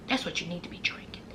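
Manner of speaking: soft, fast hushed repeat. Shown as a demonstration. Host to viewers, a quick hushed aside. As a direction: murmur it again under your breath, quick. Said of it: "plain first take".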